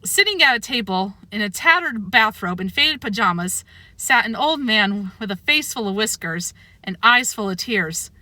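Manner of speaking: usual speaking voice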